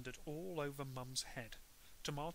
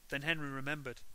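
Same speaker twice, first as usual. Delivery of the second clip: usual speaking voice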